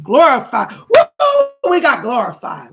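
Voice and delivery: loud whooping cheer, voice jumping high on Woo; excited cheering. Real, not acted. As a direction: celebrate the gift out loud, all-out whoop. Cheering a donor on livestream.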